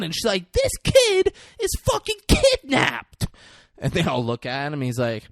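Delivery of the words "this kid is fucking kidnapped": high, slow, emphatic mock outrage; drawn-out high-pitched exclamation; acted outraged revelation. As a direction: announce it slowly in hammed-up indignation. Girlfriend blurts out the secret.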